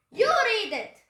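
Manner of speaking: high, loud bark; explosive captain's outburst; angry pirate order. A narrator's reading. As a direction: yell it as a furious command. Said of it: "Captain Bones shoved the map".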